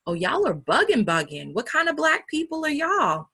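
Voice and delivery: mock astonished coworker, rising high; incredulous outburst. South African coworker scolding American colleagues, incredulous and high. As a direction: pitch jumps up in disbelief at y'all.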